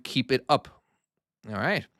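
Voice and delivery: usual speaking voice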